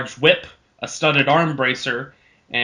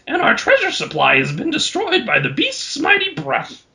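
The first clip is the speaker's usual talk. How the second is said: much higher, raspy troll voice, grinning through dire news; smiling troll. Acted. Troll chief briefs Samson on the Horn Fiend, high, smiling. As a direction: tell of the beast while barely holding back a smile. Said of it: "said Bongo with a smile on his face".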